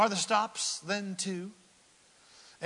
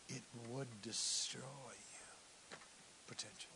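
whispered warning; 5 semitones lower, 15 dB quieter than usual. Acted. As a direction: hush it like a secret.

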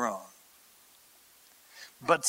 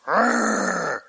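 disgusted groan; 4 semitones higher than usual. Retold or imitated. Naaman's disgust.

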